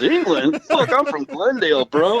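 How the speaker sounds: mock dude voice, lightly played; laid-back California dude. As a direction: toss it off like a Glendale guy correcting you. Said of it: "He goes".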